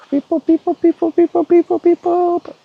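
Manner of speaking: very high robot-like beep boop; high beeping chant. Pretend. Beeping call once more.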